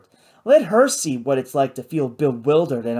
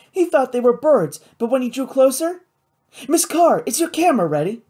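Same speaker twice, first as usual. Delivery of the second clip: put-on high-pitched excited girl passenger; eager young Kate, excited; 9 semitones higher, 4 dB louder than usual. A narrator's reading. Kate urges the photographer to be ready, high and excited. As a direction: chatter eagerly in a high, raised voice.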